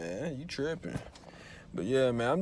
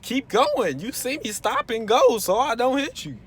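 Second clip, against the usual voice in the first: much higher and louder, calling at other drivers; exasperated yell; 12 semitones higher, 7 dB louder than usual. Retold or imitated. Driver shouting at the traffic behind him, exasperated. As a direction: yell at the cars behind to keep going. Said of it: "I'm like".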